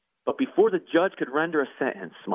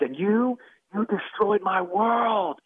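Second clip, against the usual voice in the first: mild mock yell, higher and drawn out; raised-pitch accusation, stretching the words without full volume; retold angry yell; 6 semitones higher, much slower than usual. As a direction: imitate an enraged outcry at moderate volume. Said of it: "began to yell at the top of his lungs".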